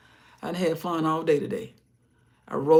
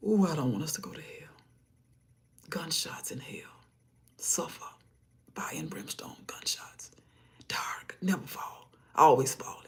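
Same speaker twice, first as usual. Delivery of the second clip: ominous whisper; 4 dB quieter than usual. Real, not acted. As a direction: whisper it like a warning. Whispered warning about hell.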